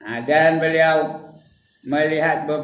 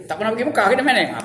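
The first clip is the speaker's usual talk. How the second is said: ordinary delivery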